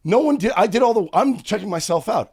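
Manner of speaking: indignant rant. Real, not acted. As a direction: vent it, fully fed up. Ranting about tip prompt.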